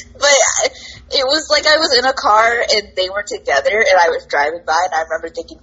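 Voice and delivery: ordinary talk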